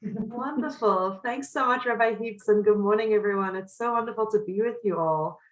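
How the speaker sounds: ordinary talk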